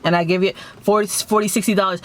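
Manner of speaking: ordinary delivery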